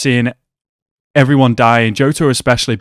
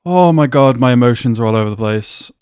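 usual speaking voice

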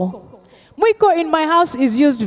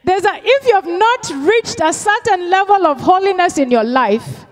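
higher, driving preacher's call; preaching at full force; 7 semitones higher, 3 dB louder than usual. Acted. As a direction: press the point home to the church. Sermon climax on holiness.

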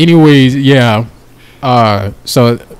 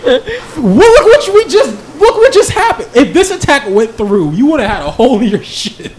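high-pitched defensive rant; worked-up, exasperated self-defence; exasperated protest; 9 semitones higher than usual. Acted. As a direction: argue back with pitch way up. Nick argues back in a quarrel.

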